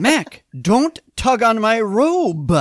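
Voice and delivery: high, loud, drawn-out mock judge's protest; Harry. Judge scolds the man tugging his robe, mock outburst. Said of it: "old Judge Harry and starts tugging on his robe".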